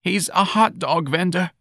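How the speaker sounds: high, plaintive statement; grieving disclosure. Acted. Mrs. Riley revealing her son's job, grief-stricken. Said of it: "her voice heavy with grief".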